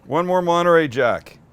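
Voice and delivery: ordinary delivery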